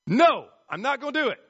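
put-on high, loud, fast childish refusal; stubborn kid. Kid refuses the parent, high loud and fast. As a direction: whine it out like a defiant kid.

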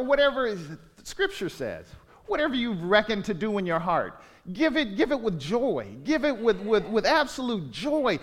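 ordinary delivery

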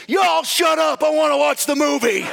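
very high, loud comic shout; retold irritated yell. Imitating some guy standing up in the cinema, exasperated yell. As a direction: yell across the room as an impatient moviegoer. Man yells at the quarrelling audience.

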